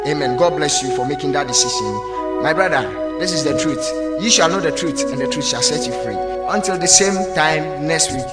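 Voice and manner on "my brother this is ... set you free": ordinary delivery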